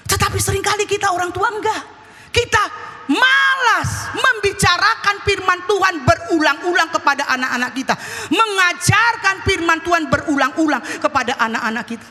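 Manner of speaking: high, emphatic sermon delivery; forceful preaching. Acted. Preaches to the congregation. (khotbah berapi-api)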